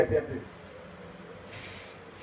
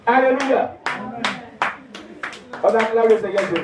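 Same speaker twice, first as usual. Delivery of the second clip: alléluia lancé fort et haut; exclamation de louange; 6 semitones higher, 3 dB louder than usual. Real, not acted. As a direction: fais acclamer la salle. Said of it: "preacher calls congregation". (acclamation de prédicateur enthousiaste)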